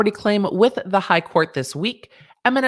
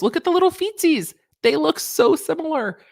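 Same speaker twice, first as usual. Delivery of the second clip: much higher, cooing over little feetsies; cutesy coo; 9 semitones higher than usual. Real, not acted. Vlogger cooing to viewers about the caterpillar feet.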